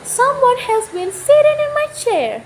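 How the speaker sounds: middle-sized mother bear voice; Mama Bear's middle-sized voice. A narrator's reading. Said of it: "said in her middle-sized voice".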